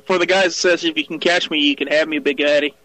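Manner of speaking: ordinary talk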